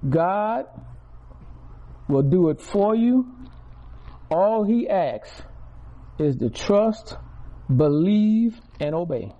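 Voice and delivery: loud, stretched preaching cadence. Acted. Pastor at full force to the congregation, preaching loudly. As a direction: preach it out, each word hammered.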